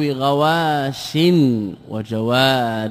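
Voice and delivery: غواش and جوار drawn out slowly; slow teaching examples. Shown as a demonstration. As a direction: lay out the examples word by word. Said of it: "يكون عوضا في ماذا؟".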